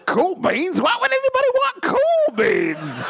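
high, hammed-up fanciful hobo. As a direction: play a whimsical old hobo. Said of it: "a fanciful hobo voice".

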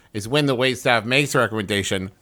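ordinary delivery

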